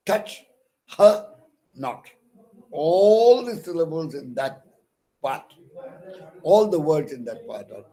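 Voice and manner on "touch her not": Touch, her, not said separately, her loud and high; each word stressed apart. Shown as a demonstration. Teacher beating out the stressed words for the class. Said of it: "stressed there".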